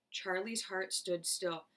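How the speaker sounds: plain reading voice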